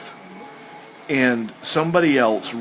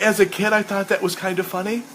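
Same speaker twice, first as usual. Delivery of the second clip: normal talking voice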